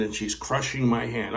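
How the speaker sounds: normal talking voice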